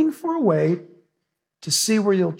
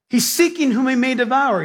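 ordinary talk, nothing marked

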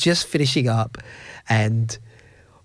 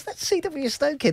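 much higher, breathless awe; stunned son spotting his hero; starstruck whisper-gasp; 14 semitones higher, 4 dB quieter than usual. Retold or imitated.

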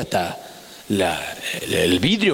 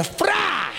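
grandma's cry; 11 semitones higher than usual. Retold or imitated. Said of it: "mi abuela decía".

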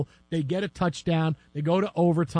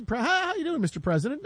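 mock greeting; 8 semitones higher than usual. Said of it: "you say".

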